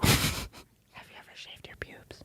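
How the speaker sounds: slow whispered question; unhurried mock-ASMR whisper. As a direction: stretch it out in a hush. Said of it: "do a little E S M R".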